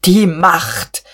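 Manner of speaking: punched, indignant outburst; short emphatic retort; worked-up exclamation. A narrator's reading. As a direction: throw the word back, agitated. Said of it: "aber auch Buck regte sich auf".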